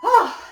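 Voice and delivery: loud, high gasp of strain; a real cry of effort near the end; breathless gasp. Real, not acted. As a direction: let out a sharp, winded ah. Winded near the end of burpees.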